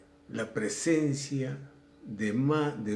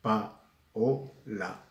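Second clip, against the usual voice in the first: Paola broken into Pa, o, la with a pause between each; teacher sounding out each syllable of Paola; split into syllables; much slower than usual. Shown as a demonstration. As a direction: spell out the syllables Pa, o, la one by one. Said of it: "se pronuncian en sílabas diferentes".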